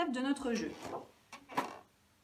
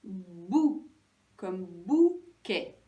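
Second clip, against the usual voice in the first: "bou, comme bouquet" slow, bou held and louder; stress on bou; 4 semitones lower, 4 dB louder, much slower than usual. Shown as a demonstration. Teacher naming the wanted syllable, stress on bou. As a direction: lean long on both bou sounds in "bou, comme bouquet". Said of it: "Et moi, je veux".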